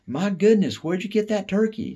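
put-on mother's friend gushing high and loud at the boy; gushing lady. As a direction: gush over the turkey in a high lady voice. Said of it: "she cried".

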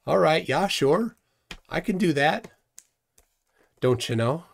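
put-on heavy Minnesotan accent. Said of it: "How about a heavy Minnesotan accent?".

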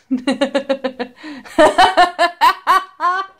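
long laugh climbing in pitch; real laughing fit. Real, not acted. Quiz taker seeing the hilarious result, real laughter. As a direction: burst into sustained laughter.

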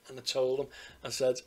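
usual speaking voice

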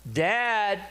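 high, loud call to Dad; imitated daughter calling. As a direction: call out to a parent in another room. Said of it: "I can hear her in the other bedroom".

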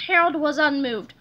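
plain reading voice

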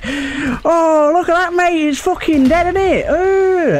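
high, hammed-up British chav accent; chavvy accent. Chav gloating over a dead pigeon, high hammed-up chav accent. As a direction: overplay a gleeful chav from the UK. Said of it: "puts on, like, the most chavvy accent ever".

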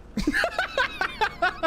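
high giggle burst; real giggle; high laugh. Real, not acted. Laugh after his joke.

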